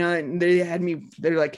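normal talking voice

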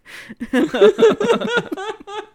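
very high shrieking laugh; real high-pitched cackle. Real, not acted. Co-host cracking up at the joke, shrieking.

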